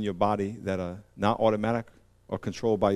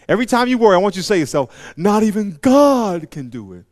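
much higher, louder preaching; preacher building to a loud high climax; full-force preaching; 8 semitones higher, 9 dB louder than usual. Acted. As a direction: drive the story home at full force.

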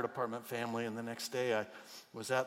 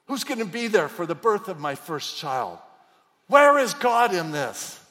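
anguished cry; 4 semitones higher, 13 dB louder than usual. Retold or imitated. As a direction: cry out in grief. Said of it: "with all the emotion you would imagine".

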